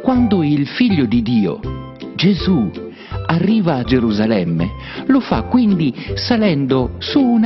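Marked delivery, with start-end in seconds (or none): none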